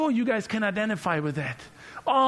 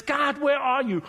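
desperate cry; 8 semitones higher, 4 dB louder than usual. Retold or imitated. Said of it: "And he says".

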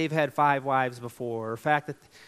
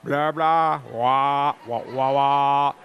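Charlie Brown teacher trombone voice; muffled wah wah talk; 8 dB louder, much slower than usual. Retold or imitated. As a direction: drone like the cartoon teacher. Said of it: "Charlie Brown, please sit down".

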